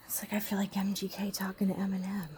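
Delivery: hushed whisper. Real, not acted. Woman confiding about her angry partner in a whisper. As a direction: keep it to a secret whisper.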